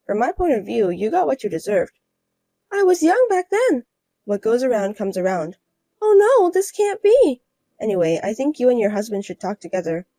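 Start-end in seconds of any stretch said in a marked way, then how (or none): 2.7-7.4 higher, louder despairing cry; higher voiced woman confronted with her past; dismayed rival wife. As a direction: wail it, higher. Defensive then dismayed.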